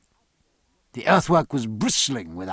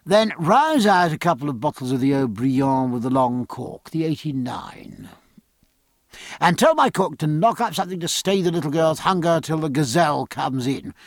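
hearty captain giving orders. Acted. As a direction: order up the wine with gusto.

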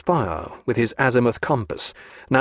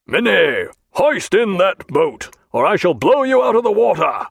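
hailing through trumpet; 7 semitones higher, 4 dB louder than usual. A narrator's reading. Captain hailing the grounded ship through a trumpet, loud hail. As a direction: hail the enemy ship, threaten. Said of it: "took a speaking trumpet and hailed".

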